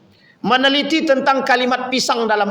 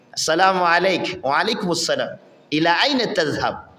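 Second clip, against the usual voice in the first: measured, lower-pitched formal Arabic speaker; fluent formal Arabic; 5 semitones lower than usual. Acted. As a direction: model polite, proper Arabic at a low pitch.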